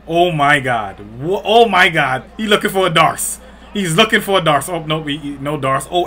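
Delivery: usual speaking voice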